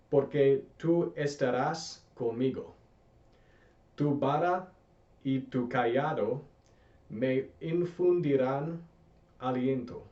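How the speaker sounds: slow, clear Spanish reading; teacher reading slowly for students to repeat. Shown as a demonstration. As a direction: read it slowly so learners can echo it. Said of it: "I'm gonna read this slowly".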